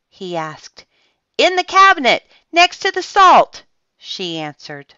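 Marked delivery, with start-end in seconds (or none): 1.4-3.7 high, loud answer called across rooms; calling back. A narrator's reading. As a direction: call the answer back to your child. Mother answers from another room.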